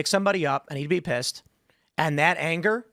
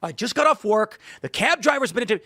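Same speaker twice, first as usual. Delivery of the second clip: exasperated husband; 6 semitones higher than usual. Retold or imitated. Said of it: "And he goes".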